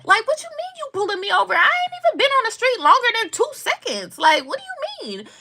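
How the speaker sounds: high, incredulous protest; indignant disbelief. Retold or imitated. Driver retelling being pulled over, indignant.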